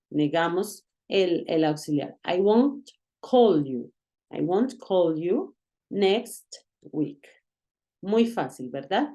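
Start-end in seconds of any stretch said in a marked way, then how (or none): 2.2-7.3 slow, careful teacher demo of "I won't call you"; slow model sentence. Shown as a demonstration. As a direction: spell out "I won't call you" slowly for learners. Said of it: "entonces decimos, "I won't". (frase modelo lenta y clara)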